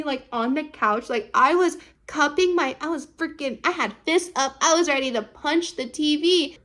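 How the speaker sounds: ordinary talk, nothing marked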